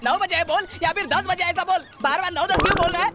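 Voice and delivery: high, worked-up demand; fed-up, high-pitched berating; exasperated scolding. Acted. Scolds man for ambiguous answer.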